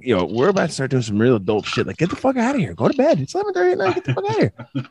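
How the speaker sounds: high, mock stern telling-off; mock scolding parent. Dad sending the kids to bed, high mock stern scold. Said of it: "I'm like".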